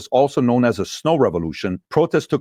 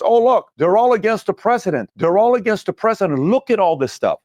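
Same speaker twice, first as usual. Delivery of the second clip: much higher, hammed-up news-anchor gush; a mock reporter getting excited about the protests; mocking the media; 8 semitones higher than usual. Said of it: "the media comes and says".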